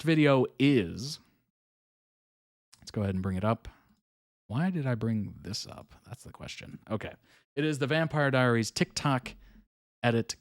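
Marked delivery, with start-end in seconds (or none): none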